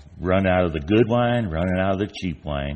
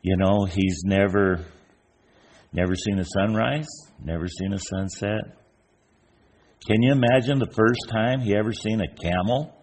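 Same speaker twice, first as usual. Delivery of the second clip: ordinary talk, nothing marked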